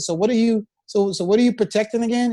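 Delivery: ordinary talk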